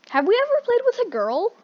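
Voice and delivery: high-pitched sing-song question. Acted. Line repeated, higher voice.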